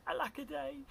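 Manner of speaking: high storybook lament; plaintive cry. A narrator's reading. Reader voices Drip left alone, high mock wail. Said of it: "And he cried".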